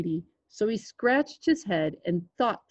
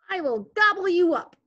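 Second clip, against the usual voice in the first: high, hammed-up troll threat; theatrical troll pitched up high; mean old troll; 11 semitones higher, 4 dB louder than usual. A narrator's reading. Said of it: "said the mean old troll".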